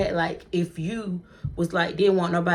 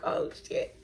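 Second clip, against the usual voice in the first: nervous whisper; 9 dB quieter than usual. Retold or imitated. Nervous aside to herself.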